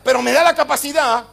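loud, high, fervent preacher driving every line home; full-force preaching. Acted. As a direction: preach it at full force to a crowd answering amen.

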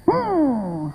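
imitating someone leaving in a huff; mock offended huff. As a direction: hum a soft, sulky huff that slides down. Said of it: "se fue enojado".

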